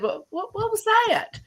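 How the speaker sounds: rising in disbelief at the camera feed; startled. Retold or imitated. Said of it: "And I said".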